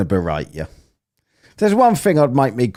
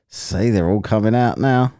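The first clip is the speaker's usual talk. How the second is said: ordinary talk, nothing marked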